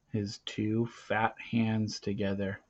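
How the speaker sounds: ordinary delivery, nothing marked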